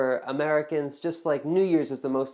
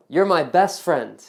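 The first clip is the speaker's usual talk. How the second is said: playful exclamation; 8 dB louder, slower than usual. Acted. Playful line to the viewer.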